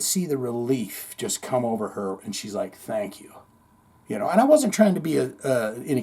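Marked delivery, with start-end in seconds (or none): 2.8-3.3 much softer, lower, almost breathed thank you; a woman letting go of her fear; relieved quiet thanks. Retold or imitated. Harassed woman thanking the man who helped her, quiet relief.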